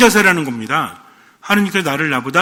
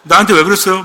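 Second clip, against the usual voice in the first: louder movie-line impression; raised-voice dramatic question; 이병헌; 5 dB louder than usual. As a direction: throw the film line out louder. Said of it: "이병헌인가 누가".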